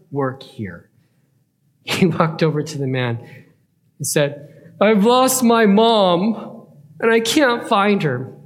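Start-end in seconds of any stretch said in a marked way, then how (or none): 4.8-8.3 put-on slow, higher, louder child's plea; lost little boy. Boy telling the store worker his mom is lost, pleading. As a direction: draw it out like a worried kid.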